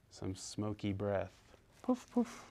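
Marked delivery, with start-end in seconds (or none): 1.8-2.4 smoke puffs. Pretend. Smoke-breathing baby dragon.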